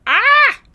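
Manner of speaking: sharp high yelp at the sour taste. Real, not acted. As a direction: yelp as the sourness hits. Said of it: "it's really sour".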